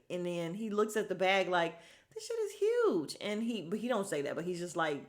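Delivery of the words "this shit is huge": squealed high-pitched awe; mock amazement. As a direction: imitate someone astonished at a huge haul. Voicing man amazed by bag.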